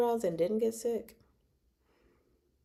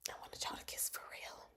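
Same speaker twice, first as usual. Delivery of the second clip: barely audible, voiceless whisper; wistful whisper; 22 dB quieter than usual. Real, not acted. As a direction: whisper it like a secret wish. Fan wishing they had kissed.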